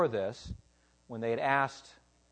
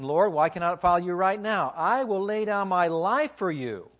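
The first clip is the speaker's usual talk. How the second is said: brash boast; 6 semitones higher, 9 dB louder than usual. Acted. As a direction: proclaim it boldly as brash Peter. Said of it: "Peter brashly said".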